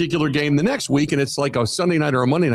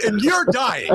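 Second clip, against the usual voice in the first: normal talking voice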